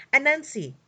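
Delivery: high, loud call of a name; hog's cry. A narrator's reading. The hog calls Anansi over to share, loud cry. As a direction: call out to catch his attention. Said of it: "cried a hog".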